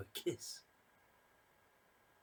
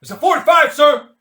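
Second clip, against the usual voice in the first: loud, high shouted answer; barked reply; 24 dB louder than usual. Acted. Keen answering Mad Mike's question, loud barked reply. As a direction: sound off like a drilled soldier.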